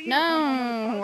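ordinary talk

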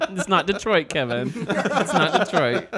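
laughing loudly mid-sentence. Real, not acted. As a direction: blurt it out through laughter. Laughing at Kevin's mistake.

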